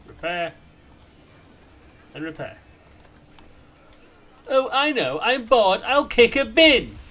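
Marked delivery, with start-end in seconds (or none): 4.5-7.0 loud, high mock voice of a bored guest; mock vandal. Voicing a park guest who kicks bins, mock loud. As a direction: play the bored vandal, hammed-up.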